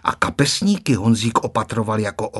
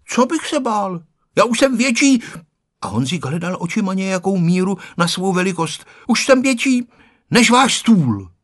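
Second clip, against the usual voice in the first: put-on proud child; 8 semitones higher than usual. A narrator's reading. Boy boasting he is bigger.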